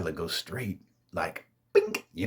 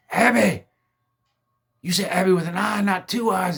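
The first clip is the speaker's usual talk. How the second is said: high, loud horrified yell; freaked-out yell at the ghost girl; panicked shout; 11 semitones higher, 8 dB louder than usual. Retold or imitated. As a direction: scream her name in shock. Sees eyes in her hand.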